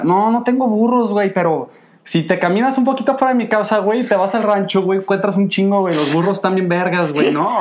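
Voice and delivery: a hint of a naive kid; naive kid self. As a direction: play his clueless younger self, brightly. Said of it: "y yo bien pendejo les decía".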